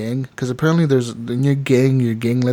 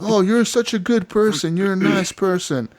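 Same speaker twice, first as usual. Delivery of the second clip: higher, sweet mock thanks; grateful stranger; 7 semitones higher than usual. Rescued traveler thanking Arthur, sweet and high. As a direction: lightly voice a grateful traveler, pitched up. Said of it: "they're like".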